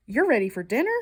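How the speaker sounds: ordinary delivery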